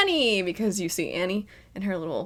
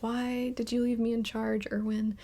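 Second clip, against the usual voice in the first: soft sad plea; 3 semitones higher, 9 dB quieter than usual. Retold or imitated. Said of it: "She's like".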